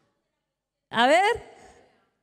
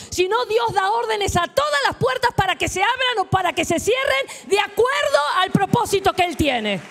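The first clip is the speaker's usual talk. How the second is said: normal talking voice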